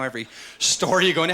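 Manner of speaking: ordinary talk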